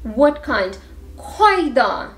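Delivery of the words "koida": "koida" stressed on "koi"; stress on first syllable. Shown as a demonstration. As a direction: hit the opening of "koida", let "da" fall. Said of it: "all have the stress on the first syllable".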